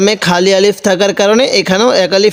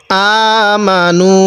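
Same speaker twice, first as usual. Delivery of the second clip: আমানু drawn out slowly with a long first a; recited demo; much slower than usual. Shown as a demonstration. Teacher showing how to read it, slow recitation. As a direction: chant আমানু slowly, stretching the opening vowel. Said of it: "এরকম করে পড়ব।".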